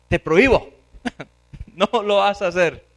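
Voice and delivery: loud, emphatic veto; stern prohibition. Acted. Peter stops Christ washing his feet, stern prohibition. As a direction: forbid it firmly and loudly.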